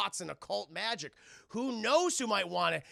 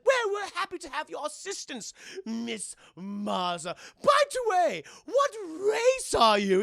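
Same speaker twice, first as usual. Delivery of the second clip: plummy Brit; 7 semitones higher, 8 dB louder than usual. Acted. British Plumbottom voiced.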